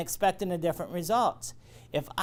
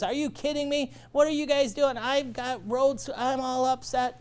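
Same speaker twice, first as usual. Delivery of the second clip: high, upset shop owner voice; upset customer; 7 semitones higher than usual. Retold or imitated. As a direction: mimic a fed-up business owner, pitched up. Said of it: "She goes".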